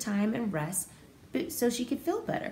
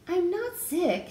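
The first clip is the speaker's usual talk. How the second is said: unwell Farfalina; 9 semitones higher, 3 dB louder, slower than usual. Acted. Farfalina telling Marcel she's fine, high and slow. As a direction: reassure him gently, high and slow.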